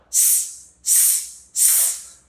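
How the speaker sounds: "S" as a voiceless hiss, not the letter name; short, soft, voiceless "S" sounds; isolated S sound. Shown as a demonstration. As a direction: hiss each "S" separately. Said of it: "make the S sound".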